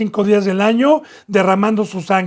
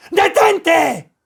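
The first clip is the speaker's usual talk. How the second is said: commanding shout; 8 semitones higher than usual. Retold or imitated. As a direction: bark the command to stop. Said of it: "con esa voz".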